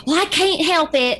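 put-on mom's Appalachian accent. Mom defending herself to the kids, imitated Appalachian accent. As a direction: protest in a high Appalachian accent. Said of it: "And she's like".

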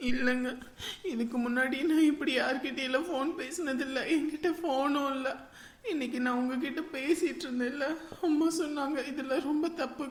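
soft, tearful confession through sobs; tearful, soft crying. Acted. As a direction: hold back tears as you confess. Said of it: "இதுக்கெல்லாமா போய் அழுவாங்க?". (அழுதபடி மெல்லிய குரல்)

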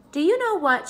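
high, eager question. A narrator's reading. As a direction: pop the question brightly. Pippi starts her news.

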